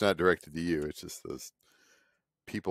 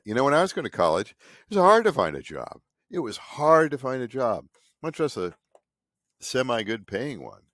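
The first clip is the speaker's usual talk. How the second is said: emphatic rant; 5 semitones higher, 5 dB louder than usual. Real, not acted. Ranting about hard times.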